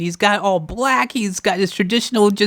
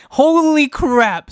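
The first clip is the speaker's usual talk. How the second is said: stunned; 6 semitones higher, 3 dB louder, much slower than usual. Real, not acted. Stunned disbelief.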